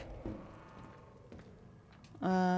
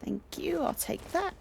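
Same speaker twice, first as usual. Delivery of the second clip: high chirpy; 8 semitones higher than usual. Real, not acted.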